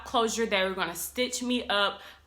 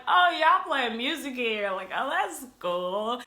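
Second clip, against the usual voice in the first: drowsy wonder; 4 semitones higher, slower than usual. Retold or imitated. Sedated in operating room.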